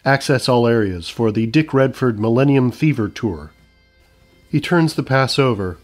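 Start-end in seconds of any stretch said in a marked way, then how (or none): none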